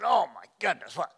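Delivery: loud high mock gasp of surprise; loud, high startled exclamation; surprised. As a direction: play a startled teenager. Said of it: "And you said".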